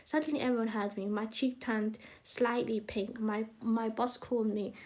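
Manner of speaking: ordinary delivery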